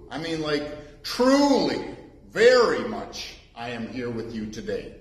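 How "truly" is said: "truly" lifted high and loud; stressed truly. Shown as a demonstration. Preacher stressing the meaning to the congregation, high emphatic truly. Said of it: "So very means truly".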